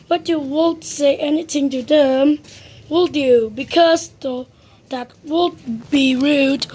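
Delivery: ordinary delivery, nothing marked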